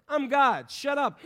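God cuts him off, loud and high; mock booming God. Preacher voicing God telling Moses to shut up, loud mock command. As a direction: bark it as an impatient God.

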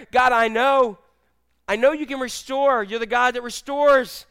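loud, high-pitched profession of faith; fervent declaration. Acted. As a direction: declare it to God with full force. Acted prayer declaring faith to God.

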